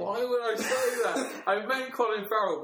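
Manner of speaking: high-pitched, incredulous laughing; laughing protest. Real, not acted. Co-host mixed up two actors, laughing protest. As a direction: protest through giggles, pitch way up.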